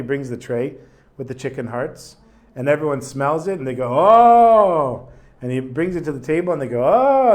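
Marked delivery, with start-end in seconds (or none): 3.9-5.1 loud high delighted "Oh!"; a hungry crowd lighting up at the food; excited gasp. Retold or imitated. Crowd smells the chicken hearts. 6.8-7.3 loud high delighted "Oh!"; a hungry crowd lighting up at the food; excited gasp. Retold or imitated. Crowd sees the tray arrive.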